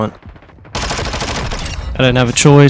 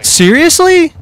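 high, incredulous squeak of outrage; can't believe it, pitch leaping up; exasperated disbelief; 4 dB louder, slower than usual. Real, not acted. Gamer outraged at another death, squeaking high in disbelief. Killed unfairly again.

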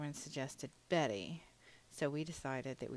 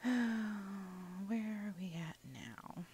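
sigh, then a slow whispered question to herself; whispered aside; 4 semitones higher, much slower than usual. Real, not acted. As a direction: breathe it out under the breath, slowly. Muttered aside to herself mid-build.

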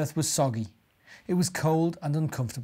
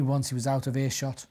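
ordinary delivery

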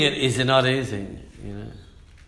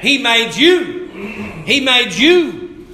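slow, higher, louder "He made you"; emphatic climax; 7 semitones higher, 4 dB louder, much slower than usual. Real, not acted. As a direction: hammer home that God made you. Preacher climaxing point.

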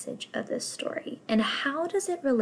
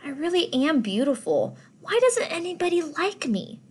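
higher, whiny lament; plaintive; 7 semitones higher than usual. Acted. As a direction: complain to the starfish. Rainbow Fish complains to starfish.

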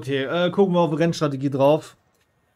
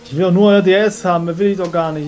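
frustrated outburst; 4 semitones higher, 11 dB louder than usual. Real, not acted. Frustrated at rivals' exits.

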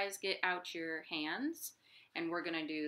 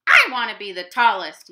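loud fast yell; 3 semitones higher, 17 dB louder, faster than usual. Acted. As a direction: shout it out fast. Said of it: "yelled Rabbit".

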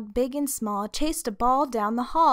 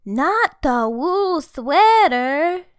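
dismayed; 6 semitones higher, 8 dB louder, much slower than usual. Acted. Horrified at bully news.

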